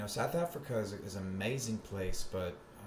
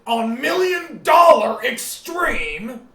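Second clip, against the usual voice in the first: much higher, louder, slow shout; sudden shout; 17 dB louder, much slower than usual. Acted. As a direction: bellow it out. Alex Jones style shouting.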